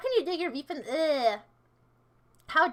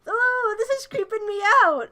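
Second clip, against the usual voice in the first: loud startled cry, then spooked; startled yelp; 7 semitones higher, 8 dB louder, slower than usual. Real, not acted. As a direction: yelp from a jump scare. Jump scare.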